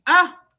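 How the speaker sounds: loud, high newborn ah; baby's ah cry. Retold or imitated. Speaker showing how newborns only say ah, loud high cry. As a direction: wail one ah like a newborn. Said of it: "Los niños solamente dicen".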